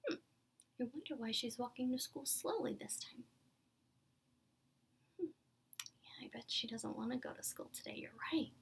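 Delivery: plain reading voice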